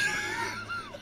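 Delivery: high shrieking laugh. Real, not acted. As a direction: burst out in a high cackle. Laughing after insult.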